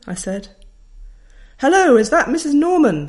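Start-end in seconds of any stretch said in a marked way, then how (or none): 1.6-3.1 loud and higher, booming down the phone; a bossy teacher booming through a bad line. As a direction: call it out like a brisk teacher. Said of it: "said a loud voice".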